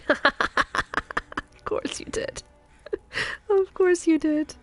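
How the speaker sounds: cracking up in a put-on accent; laughing. Real, not acted. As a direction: laugh helplessly as you say it. Cracking up at being clipped.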